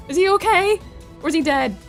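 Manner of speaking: high, loud worried question. Real, not acted. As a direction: ask in alarm. Worried after shooting.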